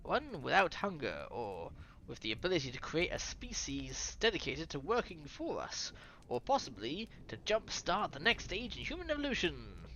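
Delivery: put-on scientist explaining in a French accent. Explaining primordial ooze to listeners, French accent. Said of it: "Here's a French voice coming".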